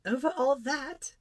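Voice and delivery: put-on high, slow child's question, rising; questioning little prince. A narrator's reading. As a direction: ask it back in amazement as a boy. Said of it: "asked the little prince".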